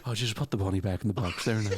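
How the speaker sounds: a gentle Irish singer's lilt, low. Retold or imitated. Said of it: "[name] in Con Air".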